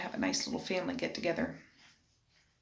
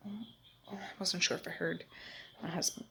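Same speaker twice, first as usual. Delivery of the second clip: whispering; 8 dB quieter than usual. Real, not acted. Listening quietly for husband.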